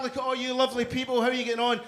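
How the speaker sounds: ordinary talk, nothing marked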